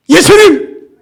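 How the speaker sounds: sudden high, very loud cry to Jesus; shouting. Acted. Preacher acts the blind beggar calling Jesus to wake dozing listeners, shouting. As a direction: yell it out to jolt the sleepers. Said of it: "제가 소리를 지르는 거예요".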